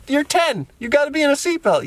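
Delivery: high-pitched incredulous protest; mock exasperation. Relative telling niece she needs a seatbelt, mock-exasperated. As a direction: imitate an exasperated relative laying down the rule. Aunt or uncle objecting to niece.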